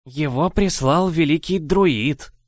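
slow, aged witch voice; old witch cutting in. A narrator's reading. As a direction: interrupt slowly, old crone. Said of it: "перебила меня Мемантуза". (старая ведьма перебивает)

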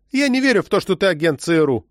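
high-pitched cry of disbelief; exclaiming. A narrator's reading. Woman aiming a pistol at the agent, exclaiming. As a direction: burst out high, then come down. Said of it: "воскликнула она".

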